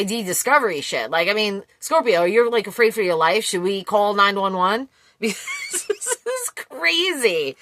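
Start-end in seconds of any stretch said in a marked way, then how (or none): 4.9-7.6 slow words through laughter; laughing real amusement. Real, not acted. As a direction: laugh it out in disbelief. Laughs at the reading.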